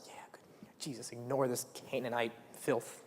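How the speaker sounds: a hushed, conspiratorial whisper to Jesus; whispered aside. Retold or imitated. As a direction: whisper it so she can't hear. Disciples voiced dismissing the woman.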